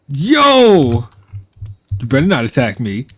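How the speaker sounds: high, loud outraged yell; frantic yelling. Real, not acted. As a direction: shout down a charging cow. Yelling at attacking cow.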